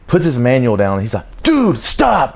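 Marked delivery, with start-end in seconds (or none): 1.4-2.4 high, loud, furious snap of a yell; angry recruit finally loses it. Retold or imitated. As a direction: explode at him to stop. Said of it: "And he beats the table".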